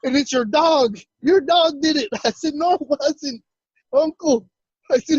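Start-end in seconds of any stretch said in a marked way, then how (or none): 0.0-2.1 high, distraught retelling of uncle's rant; yelling outrage. Retold or imitated. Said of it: "he's yelling and screaming". 2.5-4.5 high-pitched retold protest; defensive denial. Denying uncle's accusation.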